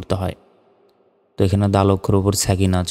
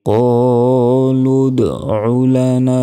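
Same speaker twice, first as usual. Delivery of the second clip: Quran recitation; 2 semitones higher, much slower than usual. Acted. Teacher recites the verse before spelling it out, slow melodic chant. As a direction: draw every word out in measured recitation.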